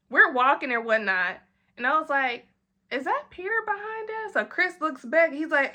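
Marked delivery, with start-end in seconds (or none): 2.9-4.3 high, soft startled question; alarmed question. Retold or imitated. Narrator spotting Peter behind them, high and soft. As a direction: notice him and squeak it.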